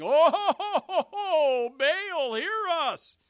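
loud, high mock wail; hammed-up howling plea to an idol; crying out, begging. False prophets calling on Baal till noon, mock wailing cry. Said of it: "they called out and cried and begged".

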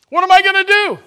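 very high, loud mock wail of panic; panicked cry. Preacher imitates a worried believer crying out to God, hammed-up panic. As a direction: throw up your hands in a hammed-up panic.

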